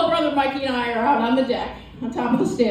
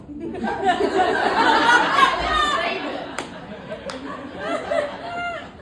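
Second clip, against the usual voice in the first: high breathy laugh; big laugh; 13 semitones higher than usual. Real, not acted. Comic laughing at his own dog fear. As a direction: burst out laughing.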